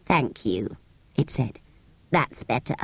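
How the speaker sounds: a small grumpy creature, low and soft; low sand fairy voice. A narrator's reading. Sand fairy thanking Anthea, low and soft.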